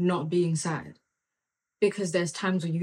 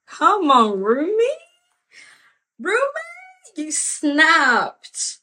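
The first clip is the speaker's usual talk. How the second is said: high, loud cry of delight at Rumi; excited shout; 11 semitones higher, 9 dB louder, slower than usual. Real, not acted. Preacher shouting praise for Rumi's quote, excited. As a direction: holler it like the quote just floored you.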